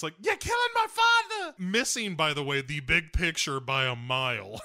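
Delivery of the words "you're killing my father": very high, hammed-up anguished scream; Liv Tyler screaming. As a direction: shriek it as an overblown movie daughter. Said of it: "Liv Tyler screams".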